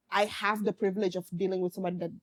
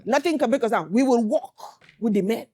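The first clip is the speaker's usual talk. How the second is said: louder, forceful voice of strong women; mock strong women; 2 semitones higher, 5 dB louder than usual. Daughter voicing her mother's generation of strong women, loud and defiant. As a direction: imitate tough women, with force. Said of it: "we are the strong women".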